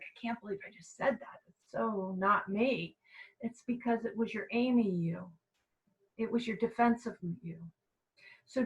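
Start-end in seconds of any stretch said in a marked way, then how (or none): 0.0-2.9 shocked self-talk. Retold or imitated. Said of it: "we're kinda shocked".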